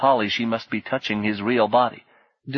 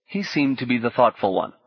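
ordinary delivery, nothing marked

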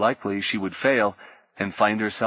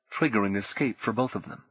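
ordinary delivery

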